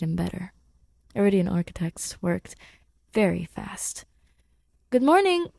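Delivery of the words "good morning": higher and louder cheery hello; bright greeting. Acted. Grace greeting Simon brightly. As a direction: call out a happy good morning.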